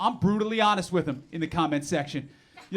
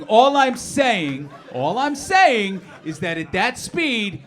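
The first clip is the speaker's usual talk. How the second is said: slow emphatic; 5 dB louder, much slower than usual. Acted. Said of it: "emphatic repeat".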